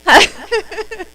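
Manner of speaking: high, loud burst of real laughter at herself. Real, not acted. Laughing at her own flipped phone greeting, high and loud. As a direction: crack up at your own slip.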